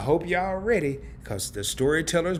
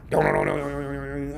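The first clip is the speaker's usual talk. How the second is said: imitated alarm chime. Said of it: "I walk in the house".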